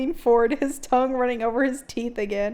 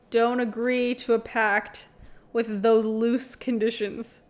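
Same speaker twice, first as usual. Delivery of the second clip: normal talking voice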